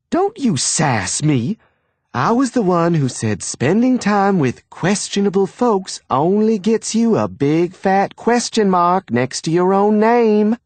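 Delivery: high, scolding Southern mother. A narrator's reading. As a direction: wag a finger and lecture. Mother scolding her son.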